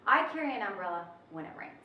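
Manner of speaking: I carry an umbrella when it rains, fast with no pause at all; one smooth flow. Shown as a demonstration. Teacher showing students the sentence with no comma, fast unbroken flow. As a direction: run it straight through, no break. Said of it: "it flows all the way through".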